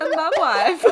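Borat. Acted. Listener mocks the failed British accent, hammed-up Borat voice. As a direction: throw in a hammed-up catchphrase accent. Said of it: "is just Borat".

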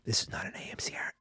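hushed ASMR-style whisper; joking whisper. Pretend. Host joking this is not an ASMR, whispered. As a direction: drop to a soft, voiceless whisper. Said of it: "I'm not gonna whisper".

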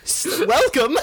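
big sweeping sing-song welcome; booming sing song welcome. Acted. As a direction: boom out the welcome like a showman. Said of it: "I envisioned that was another character".

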